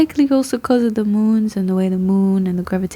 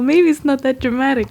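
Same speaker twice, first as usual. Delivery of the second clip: ordinary talk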